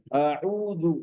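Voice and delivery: अऊदु with a clear hamza and ain; अऊदु pronounced carefully; correct pronunciation. Shown as a demonstration. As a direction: say अऊदु with a glottal start and a throaty ain. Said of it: "अऊदु में पहला हमजा है".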